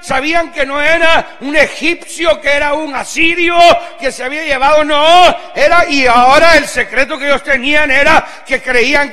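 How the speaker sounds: loud, high, driving sermon shout; preaching at full force. Acted. Preacher rousing his congregation, shouting at full force. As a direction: hammer the message home to the hall.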